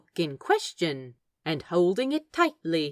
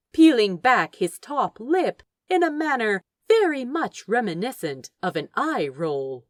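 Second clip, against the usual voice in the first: ordinary delivery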